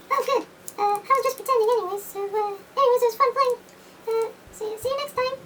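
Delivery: high, quick, cheerful cat voice; the toy cat saying goodbye, squeaky and high; Mister Cat. Pretend. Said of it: "Mister Cat".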